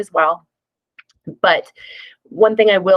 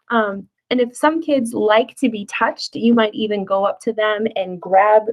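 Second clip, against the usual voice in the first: normal talking voice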